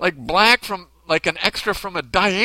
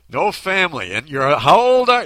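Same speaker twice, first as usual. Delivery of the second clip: loud, higher, mock-astonished repeat; hammed-up public disbelief at a lone guest; imagined incredulous usher; 3 semitones higher, 6 dB louder than usual. Usher imagined questioning a man alone at Christmas mass, mock incredulous. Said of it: "in my head it's like that".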